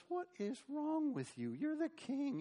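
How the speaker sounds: lightly voiced Jezebel, scolding her husband; scolding queen. Jezebel scolds her sulking husband, lightly voiced and high.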